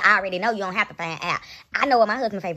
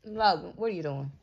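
quiet aside; 5 semitones lower, 8 dB quieter than usual. Real, not acted. Speaking aside to child.

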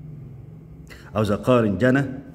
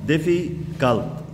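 "ديفيكالت" slow and higher, broken into beats; syllable by syllable; 4 semitones higher, much slower than usual. Shown as a demonstration. Teacher counting syllables in a long adjective, slowly split up. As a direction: pull "ديفيكالت" apart slowly, piece by piece. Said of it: "مقطعين".

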